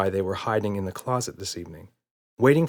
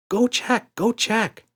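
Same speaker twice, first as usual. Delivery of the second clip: put-on much higher, insistent child's voice; eager little girl; 13 semitones higher, 3 dB louder, slower than usual. A narrator's reading. Emma begs Daddy to check the closet, eager child. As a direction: urge him like an eager kid.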